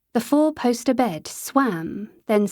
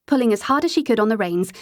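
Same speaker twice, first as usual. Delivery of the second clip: ordinary delivery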